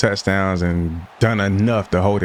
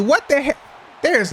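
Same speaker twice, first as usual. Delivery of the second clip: shrill, high squeal of disbelief; exasperated outburst. Real, not acted. Gamer yells at a receiver who turned around, high and exasperated.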